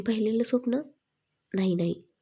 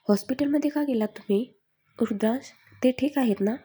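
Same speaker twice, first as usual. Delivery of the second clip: frightened question; 4 semitones higher than usual. A narrator's reading. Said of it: "तिने घाबरतच विचारलं".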